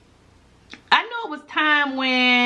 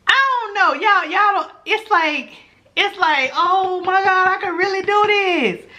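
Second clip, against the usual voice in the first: excited recall; 9 semitones higher than usual. Retold or imitated. To viewers, recalling her first subscribers, excited. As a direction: relive the joy of the first hundred. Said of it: "recalling first hundred subscribers".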